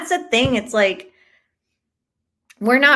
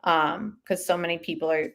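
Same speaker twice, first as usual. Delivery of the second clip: ordinary talk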